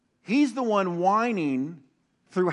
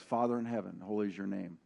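hushed, much softer reverent prayer; quiet prayer; 8 semitones lower, 11 dB quieter, faster than usual. Retold or imitated. A pastor quotes the start of the Lord's Prayer, hushed. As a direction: murmur it low as a private prayer. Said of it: "'cause that's what Jesus says".

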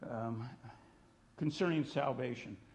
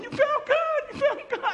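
high, loud, gleeful squeal with laughter; excited girls shouting; 9 dB louder than usual. Retold or imitated. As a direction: mimic two girls bouncing on the bed. Girls jumping on bed, shouting.

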